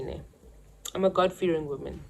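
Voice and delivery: ordinary talk, nothing marked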